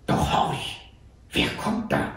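whispered, tense listening; whispered alarm. A narrator's reading. Sentry at night hearing hoofbeats, whispered. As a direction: hush it, straining to hear. Sentry hears a rider approach.

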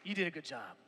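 soft, low and sincere; tender reassurance, almost hushed; heartfelt encouragement. Real, not acted. Pastor sincerely encouraging fathers from the heart, softly. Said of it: "from the bottom of my heart".

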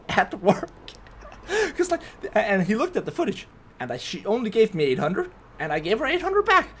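high-pitched, giggly telling; laughing. Real, not acted. Explaining to viewers why there was no problem, laughing. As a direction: push the words out while laughing.

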